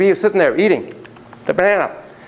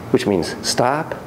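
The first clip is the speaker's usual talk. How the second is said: usual speaking voice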